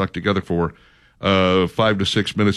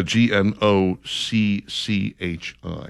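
slow, letter by letter; spelling out; much slower than usual. Real, not acted. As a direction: spell it out slowly, each letter apart. Spelling out a puzzling word.

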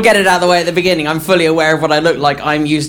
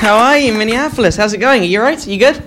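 upbeat crowd greeting; 7 semitones higher than usual. Real, not acted.

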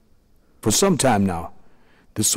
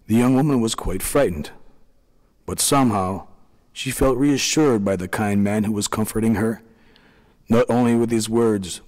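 plain reading voice

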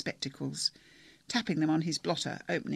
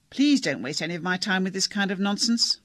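louder, curt interruption; cutting her off; 3 semitones higher, 7 dB louder than usual. Acted. Doctor interrupts Trish, curt and louder. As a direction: shut her down firmly.

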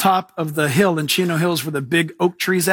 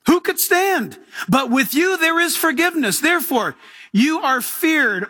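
raised, intoned psalm reading; 7 semitones higher than usual. Acted. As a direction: cry the psalm out to the Lord. Reads psalm in preaching voice.

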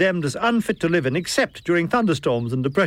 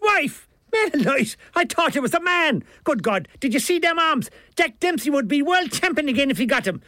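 high, aghast Irish character voice; astonished Milligan; 10 semitones higher than usual. A narrator's reading. Milligan learns that was his wife, high astonishment. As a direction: exclaim in comic disbelief, pitched up.

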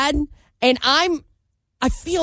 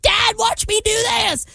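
put-on high, slow kid shouting for his dad; high-pitched kid yell; bratty kid; 11 semitones higher than usual. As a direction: call out like a showing-off child. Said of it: "this kid's like".

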